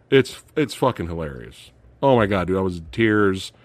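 normal talking voice

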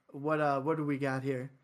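usual speaking voice

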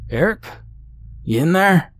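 high call through the front door. A narrator's reading. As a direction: call out to a friend behind the door. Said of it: "Henry's familiar voice called from the front door".

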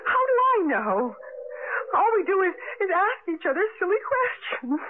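a frightened woman losing control, climbing into shrill sobs; hysterical panic. Acted. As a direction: spiral into terror until she breaks. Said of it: "Joan, stop it".

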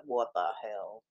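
low, soft what the hell under breath; a quiet disbelieving aside; muttered disbelief. Retold or imitated. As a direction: mutter it, baffled. Reacting to the hearts emojis.

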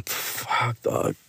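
ordinary delivery

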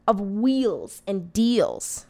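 usual speaking voice

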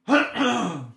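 high, brief throat clear; stubborn throat clear; real throat clearing. Real, not acted. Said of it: "Goddamn frog in my throat".

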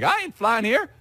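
mock high-pitched refusal. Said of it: "She would jump like".

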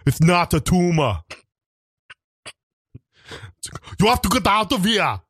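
put-on Austrian accent, spaced out like Arnold; Arnold Schwarzenegger impression. Arnold impression, Austrian accent, for the reel. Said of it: "That's my Arnold Schwarzenegger impression".

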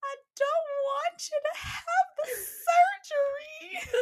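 imitating a scared kid, high and drawn-out; loud, slow, squeaky-high mock child plea; little mouse voice. Scared son pleading with mom over surgery, imitated little mouse voice. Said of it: "with his little mouse voice".

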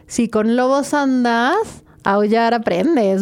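slow, deliberate proverb; quoting a proverb. Retold or imitated. Guest slowly quoting an old proverb. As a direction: spell the saying out slowly. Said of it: "es cierto el".

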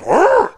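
short, high, loud imitated roar; bear roar. Bear lights up before rushing him, roaring. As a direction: burst out like an excited bear. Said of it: "The bear lights up, just".

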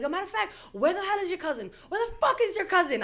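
high-pitched, indignant demand without extra volume; retold anger. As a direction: re-enact being so mad, voice shooting up high. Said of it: "I was so mad".

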